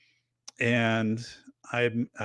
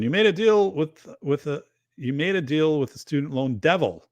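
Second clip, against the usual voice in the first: normal talking voice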